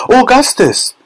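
very high-pitched mother's shout; high, urgent calling cry; Missus Gloop. A narrator's reading. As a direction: call out shrilly to your son. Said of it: "shouted Missus Gloop".

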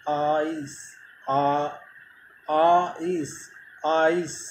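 British "आइस", with the आ stressed and the ई light; British English "आ, इस", the आ leaned on; British English "ice". Shown as a demonstration. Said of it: "stress डालते हैं आ पर". (British अंदाज़ में "आइस", आ पर ज़ोर)

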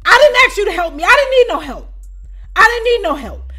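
high, loud angry rebuke; indignant scolding. Retold or imitated. Scolding the woman who touched her food, high loud rebuke. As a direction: tell her off hard.

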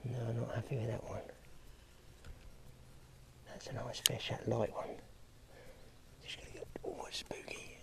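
very soft, breath-only murmur; real whisper. Real, not acted. Angler watching a nervous fish, whispering. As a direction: whisper so nothing gets spooked. Stalking wary fish up close.

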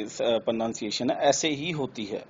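normal talking voice

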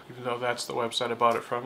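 ordinary talk, nothing marked

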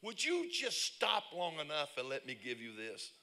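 a mildly exasperated plea to just stop; exasperated stranger. Retold or imitated. Stranger begging the panicked woman to stop, exasperated plea. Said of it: "He said".

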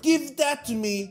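ordinary talk, nothing marked

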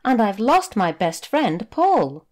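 put-on a young boy telling of his lost friend; upset little boy.

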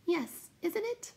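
slow, very high little voice; reply in a tiny drawn-out pipe; faint smile. A narrator's reading. Will-o'-the-Wisp answers the Night Hob, high faint smile. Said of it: "smiled faintly".

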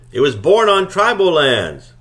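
loud, high insistent shout. A narrator's reading. Miss Cleary shouting her point at the couple. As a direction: keep shouting the point home. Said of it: "Miss Cleary shouted".

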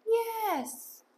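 high, drawn-out praise marker; sing-song positive reward word; extra positive. Shown as a demonstration. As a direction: praise the dog with an extra-happy, high yes. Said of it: "Keeping it extra positive".

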